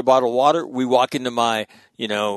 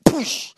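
mock headbutt sound effect; 12 semitones higher than usual.

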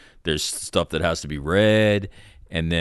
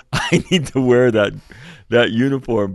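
laughing it out, louder and higher; 4 semitones higher, 6 dB louder than usual. Real, not acted. Recruit laughing about dreaming of wearing that uniform. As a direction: laugh through the wish.

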